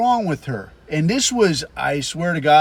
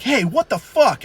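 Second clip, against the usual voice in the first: high mock indignant shout; angry woman; 6 semitones higher than usual. Woman who chased him down, mock indignant shout. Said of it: "this fucking broad chased me down and was like".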